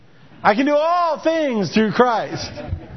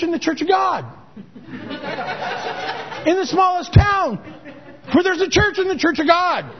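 higher, laughing disbelief at his own small pay; incredulous retold protest; 7 semitones higher than usual. Pastor tells lonely millionaire he is poor, incredulous with laughter. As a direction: laugh it off as if the idea were absurd.

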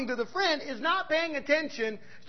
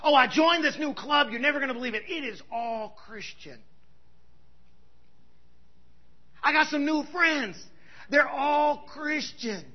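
mocking churchgoer; 3 dB quieter than usual. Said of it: "ruffle some feathers".

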